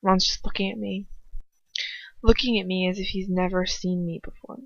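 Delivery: ordinary delivery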